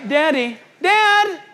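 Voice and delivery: high, loud call of "Daddy, Dad"; child calling daddy. Retold or imitated. Preacher shows how we would call our dad, high and loud. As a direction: sing out "Daddy, Dad" like a kid wanting his father. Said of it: "We would say".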